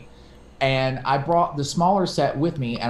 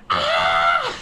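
mock shriek; 9 semitones lower, 4 dB louder than usual. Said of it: "she was just like".

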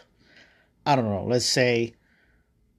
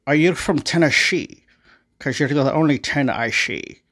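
hammed-up Scottish movie-star impression, S's slurred to sh; Sean Connery; 3 semitones higher, 3 dB louder than usual. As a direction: put on a thick mock-Scottish accent. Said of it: "Mister Sean Connery".

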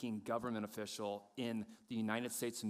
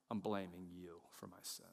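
low, soft admission; subdued blame; 8 semitones lower, 8 dB quieter than usual. Acted. Adam now blames God for his sin, low and quiet. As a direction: drop right down in pitch and volume. Contrast with delighted bridegroom.

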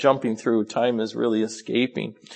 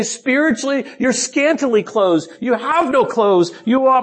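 higher-pitched preaching, building then slowing to a close; impassioned preaching; 7 semitones higher, 3 dB louder than usual. Acted. Preacher rebuking the spiritually unclothed congregation, impassioned. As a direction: press it home like a fervent preacher.

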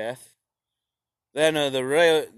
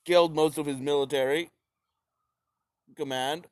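ordinary talk, nothing marked

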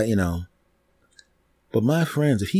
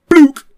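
dull blook; 11 dB louder than usual. Retold or imitated. Bottle hits wall again.

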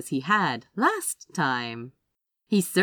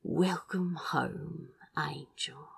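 much softer, slow, fading into a whisper; intimate whisper; 10 dB quieter, slower than usual. Acted. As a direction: breathe the name like a secret. Intimate welcome to his lover.

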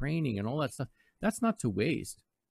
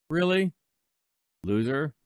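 louder, higher incredulous question; mock disbelief; 6 semitones higher, 5 dB louder than usual. Host replying to texter, mock disbelief. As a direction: scoff in feigned shock. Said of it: "I said".

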